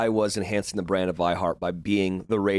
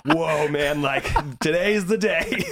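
hammed-up bucket, higher and slow; talking bucket voice; 5 semitones higher, slower than usual. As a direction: play the bucket, awed, dragging the words. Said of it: "taking it from the perspective of the bucket".

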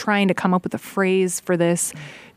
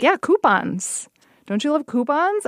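hammed-up Midwestern accent; 7 semitones higher than usual. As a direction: lean into a cheery Midwestern accent, higher. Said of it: "I cannot say coupons in a not Midwestern accent".

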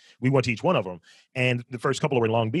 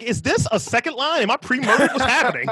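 much higher, hammed-up alarm; mock panic; 11 semitones higher than usual. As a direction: sell the fake dread, half laughing. Retold panic at brass band.

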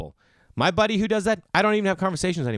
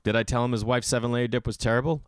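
usual speaking voice

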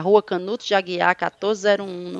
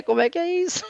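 laughing; 10 semitones higher, much faster than usual. Real, not acted. Laughing disbelief at price joke.

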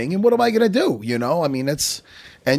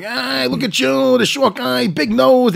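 high, slow, loud taunting yell; retelling a heckler yelling insults at a short guy; mock carny heckle; 12 semitones higher, 6 dB louder, slower than usual. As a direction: jeer at a passer-by like a carnival barker. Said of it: "he kept yelling out things".

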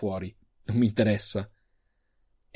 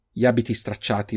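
usual speaking voice

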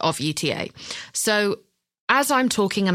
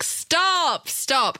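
high, hammed-up fake modesty; mock-bashful protest to cheering agents, pantomime style; 9 semitones higher than usual. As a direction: wave off applause you secretly love. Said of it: "It's all very pantomime".

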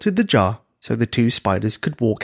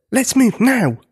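urgent; 11 semitones higher, slower than usual. A narrator's reading. Spider urges escape from giant.

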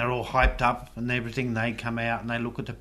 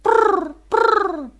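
high loud pigeon trill; pigeon coo; 9 dB louder than usual. Shown as a demonstration. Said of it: "we go brrr".

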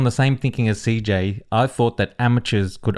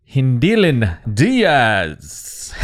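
very slow, drawn-out announcement; grand name reveal; much slower than usual. Real, not acted. Proud fan naming the gold-winning weightlifter, slow grand reveal.

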